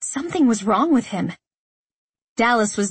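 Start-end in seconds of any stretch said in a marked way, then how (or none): none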